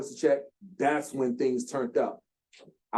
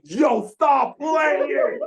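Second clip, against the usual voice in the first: high, loud, drawn-out shouted greeting; playful shout; 13 semitones higher, 7 dB louder, much slower than usual. Retold or imitated. [name] coming on camera, shouting a slow, high, loud hello to the founder. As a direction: holler it slowly like greeting an old friend. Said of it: "He comes on camera like".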